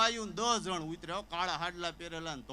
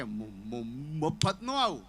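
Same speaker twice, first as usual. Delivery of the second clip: stuck on the first sound, then blurts the rest; stammering friend; 5 semitones lower, 3 dB louder, much slower than usual. Retold or imitated. Friend who stammers, agreeing to come along, stuttering. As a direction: stutter hard on the opening syllable. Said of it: "એને થોડીક break ચોંટે છે બોલવામાં".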